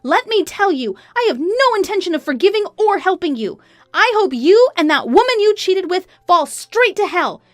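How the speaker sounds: high, loud, relentless tirade; furious outburst. A narrator's reading. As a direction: rage at the cheating husband. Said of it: "I let out all the anger".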